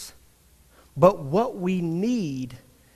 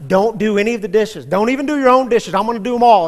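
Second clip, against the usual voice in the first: louder, faster, eager promising; frantic apology; 3 semitones higher, 7 dB louder, faster than usual. Retold or imitated. Husband over-promising to his wife about the dishes, loud and eager. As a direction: blurt it out, desperate to make amends. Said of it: "I said".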